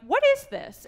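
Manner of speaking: high startled squeak; shocked. Retold or imitated. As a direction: blurt it out in disbelief. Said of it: "I'm like".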